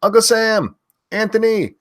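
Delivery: loud high name shout; screaming names. Real, not acted. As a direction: belt the viewer's name out. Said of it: "I have to stop screaming that".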